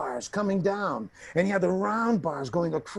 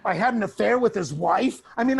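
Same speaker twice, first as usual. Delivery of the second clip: ordinary talk, nothing marked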